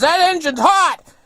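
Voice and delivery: very high, loud, slow shout; imitating the old fellow hollering; retold yell. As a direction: yell an urgent danger warning. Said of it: "he yells".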